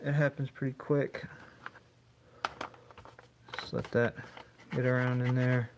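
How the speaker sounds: talking through a welding mask; muffled voice behind a face mask. Real, not acted. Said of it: "can't talk too good with this mask on".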